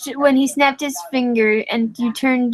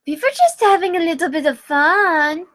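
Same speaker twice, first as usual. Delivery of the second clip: higher, sulky and cute; pouting; 5 semitones higher than usual. Acted. Reader pouting at Ludwig, mild sulk. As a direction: pout at Ludwig. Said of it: "you pouted".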